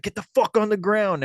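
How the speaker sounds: loud, high retold command; threatening order. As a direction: imitate an armed man yelling an order. Armed man orders boys down.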